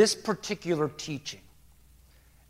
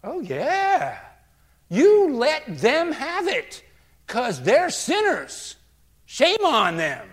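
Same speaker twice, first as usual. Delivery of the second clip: loud, high, drawn-out cheering on; gleeful egging on; 8 semitones higher, 6 dB louder, slower than usual. Acted. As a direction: relish every slow word of condemnation. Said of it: "I'm like".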